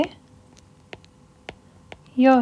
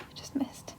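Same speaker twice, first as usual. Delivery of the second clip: whispered aside; 21 dB quieter than usual. Real, not acted. Aside about missed accent.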